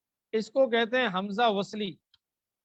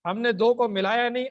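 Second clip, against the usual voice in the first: ordinary talk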